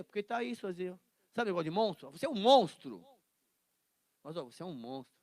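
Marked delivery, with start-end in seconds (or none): 2.1-3.0 loud accusation. Shown as a demonstration. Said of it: "dependendo da entonação".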